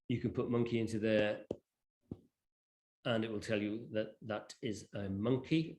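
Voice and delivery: normal talking voice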